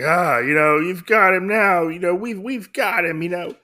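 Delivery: high, elated villain imitation; mock gloating happiness. Oda thinks they've got him now, imitated happy crowing. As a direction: crow in triumph as the happy lord, acted. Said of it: "Oda's very happy".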